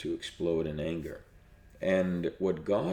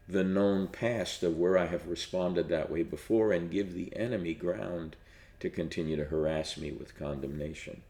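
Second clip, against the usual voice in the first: ordinary delivery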